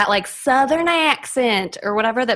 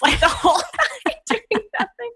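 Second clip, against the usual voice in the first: laughing. Real, not acted. Laughing at teenage memories.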